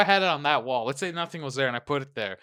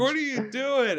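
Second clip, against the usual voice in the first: high, drawn-out mock protest; exasperated outcry; 10 semitones higher, slower than usual. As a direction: wail it slowly in disbelief. Repeats outcry at the driver.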